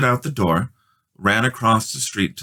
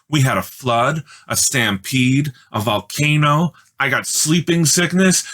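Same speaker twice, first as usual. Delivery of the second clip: higher, louder and louder excited telling; boy eagerly tells the guests; 6 semitones higher, 3 dB louder than usual. A narrator's reading. Peter excitedly recounts adventures.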